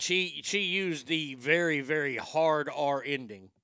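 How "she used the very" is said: normal talking voice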